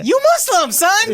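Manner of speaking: mocking cop taunting a Sudanese friend, high put-on voice. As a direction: squeal it like a jeering cop. Mocking the cop's racist line.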